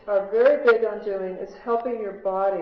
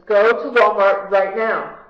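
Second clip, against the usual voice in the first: slow, louder urgent order; a little louder command; 9 dB louder, slower than usual. Retold or imitated. The angel voice insists a second time to the arguing nurse, louder and slower. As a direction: push it out slower and more insistent, a notch up. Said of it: "the voice then gets a little louder and says".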